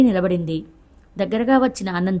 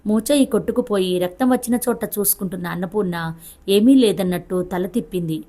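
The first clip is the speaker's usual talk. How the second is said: ordinary delivery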